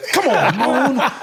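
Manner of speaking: slow, high, laughing "come on" from the coach; exasperated coach. Retold or imitated. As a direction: play the fed-up coach, drawn out and high. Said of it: "he'd be like".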